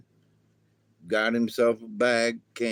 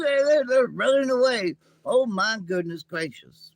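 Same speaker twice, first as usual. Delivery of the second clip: high excited alien; 9 semitones higher, 3 dB louder than usual. Acted. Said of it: "Ima says".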